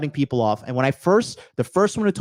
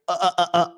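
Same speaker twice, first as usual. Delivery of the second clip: short repeated "uh" sounds; imitated flustered stammer; 3 semitones higher, faster than usual. Imitating people left without a language, flustered stammer. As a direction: mimic someone stuck for an answer.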